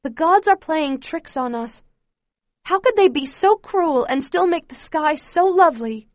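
rising bitter anguish. A narrator's reading. Bitter outburst at the gods.

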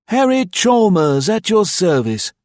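high-pitched, loud, cheery greeting; hearty innkeeper voice, pitched well up; cheerful host. A narrator's reading. Host greets his new guests at the car, cheerfully. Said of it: "he said cheerfully".

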